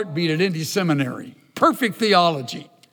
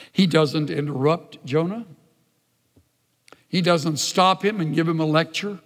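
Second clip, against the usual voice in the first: ordinary delivery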